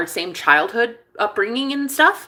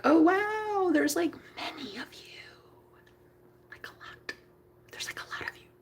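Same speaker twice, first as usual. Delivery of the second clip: soft high "wow" fading into whisper; awed whisper; 8 semitones higher, 10 dB quieter than usual. Retold or imitated. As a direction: whisper it as a dawning realization. Retold awed reaction, sinking to whisper.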